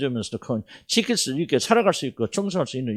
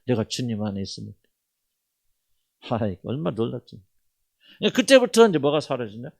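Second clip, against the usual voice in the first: ordinary talk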